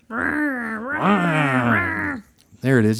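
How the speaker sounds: high buzzing chainsaw whine; chainsaw impression. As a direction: imitate a revving chainsaw. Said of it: "do your best chainsaw impression".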